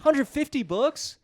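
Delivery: very high, drawn-out disbelieving question; incredulous. Real, not acted. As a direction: squeak the number back in disbelief. Incredulous at the claimed number.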